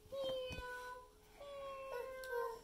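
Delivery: very high, soft kitten mew; baby kitty. Acted. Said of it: "the baby kitty says".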